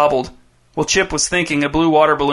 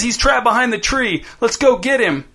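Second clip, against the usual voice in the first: put-on higher eager kid's voice; Dez urging; 8 semitones higher than usual. A narrator's reading. Dez urges Jace to chase Chip, high eager voice. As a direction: egg him on, keen. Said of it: "shouted Dez".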